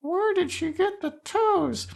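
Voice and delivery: very high, soft falsetto stand-in voice; listener's imagined question. Pretend. Host playing the listener asking themselves, in a soft high mock voice. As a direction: squeak it softly, far above your own pitch. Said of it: "please ask yourself that question right now".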